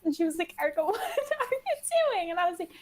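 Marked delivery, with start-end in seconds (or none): 0.6-2.3 high, laughing disbelief; laughing quote. Retold or imitated. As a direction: laugh through the question. Friend's quoted surprised question.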